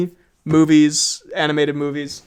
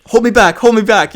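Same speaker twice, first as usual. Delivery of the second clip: higher, louder mock challenge; mock fighter's bravado; 4 semitones higher, 7 dB louder than usual. Retelling the lads' almost-fight, mock bravado. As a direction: play the lad begging to be restrained.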